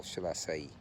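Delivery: usual speaking voice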